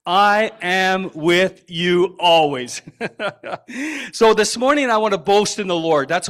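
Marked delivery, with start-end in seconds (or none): 0.1-2.8 slow and louder, every word of "I am with you always" stressed; said together emphatically. Shown as a demonstration. Pastor leads the congregation in unison, slow and emphatic. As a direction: lead the room through "I am with you always", word by word. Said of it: "Can we say that together?".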